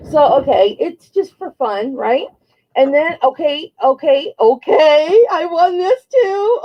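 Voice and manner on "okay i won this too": high, thrilled, almost crying with joy; excited squeal. Real, not acted. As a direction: gush over the find. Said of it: "I get excited".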